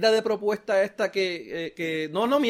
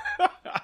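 short, very high laugh; 3 dB quieter than usual. Real, not acted. Co-host laughing while talk turns to broke towns, short high laugh. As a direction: let out a brief high-pitched laugh.

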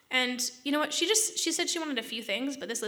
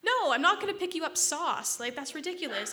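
outraged refusal; 5 semitones higher than usual. Retold or imitated. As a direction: throw it back at her, appalled. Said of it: "I'm like".

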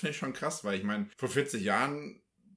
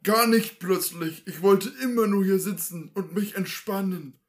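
stubborn husband; 8 semitones higher, 8 dB louder than usual. Acted. Husband defending his rest to wife, placid. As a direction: calmly insist on just sitting. S1 plays husband in Loriot sketch.

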